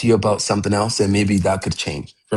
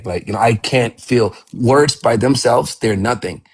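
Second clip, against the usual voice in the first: normal talking voice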